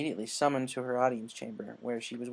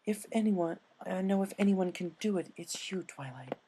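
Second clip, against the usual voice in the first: higher elegant lady pony voice; higher voiced elegant unicorn with an idea; refined Rarity; 6 semitones higher than usual. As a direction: play a poised, eager fashionista.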